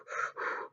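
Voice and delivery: quick blown breaths, reenacted; acted puffs of anxious breath; panicked breathing. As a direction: imitate panting on the phone. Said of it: "I'm panicking on the phone".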